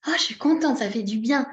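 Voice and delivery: heartily pleased; acted happiness. Shown as a demonstration. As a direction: let the pleasure burst out, then settle. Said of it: "ça réchauffe le cœur".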